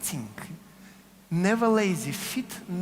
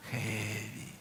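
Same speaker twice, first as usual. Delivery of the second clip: heavy, grounded cue; 8 semitones lower, 8 dB quieter than usual. Shown as a demonstration.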